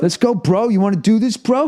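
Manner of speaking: high, cocky frat bro challenge; gym bro. Retold or imitated. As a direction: talk tough like a frat bro.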